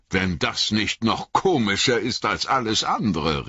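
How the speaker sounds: mildly astonished country farmer; surprised exclamation. A narrator's reading. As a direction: exclaim in amused disbelief. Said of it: "rief er".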